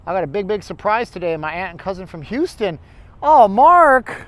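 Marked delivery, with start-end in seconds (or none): 3.2-4.3 high, loud, gushing congratulations; excited gushing. Retold or imitated. Aunt gushing loudly to Mark about family visit. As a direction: gush over him like a thrilled aunt. Cousin's excited greeting voiced.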